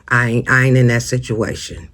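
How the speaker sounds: ordinary delivery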